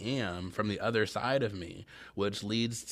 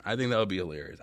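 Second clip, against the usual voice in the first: ordinary talk, nothing marked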